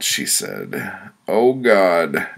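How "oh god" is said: breathless pained gasp; short of breath. A narrator's reading. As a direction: gasp it out winded. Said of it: "I was short of breath".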